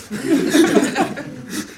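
high breathy kangaroo giggle; kangaroo laugh. Acted. (hohes, gehauchtes Känguru-Kichern)

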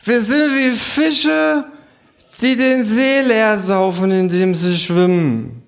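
high, slow, slurred kangaroo voice; drunken kangaroo. A narrator's reading. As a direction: drag each word out high and drunk. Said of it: "sagt das Känguru".

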